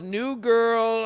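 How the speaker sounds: ordinary delivery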